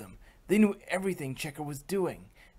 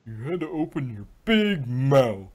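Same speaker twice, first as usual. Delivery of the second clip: louder complaining thug voice; gruff henchman; 6 dB louder than usual. A narrator's reading. Tied-up chauffeur scolding his partner, gruff complaint. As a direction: snap at the blabbing partner. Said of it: "Said one of them".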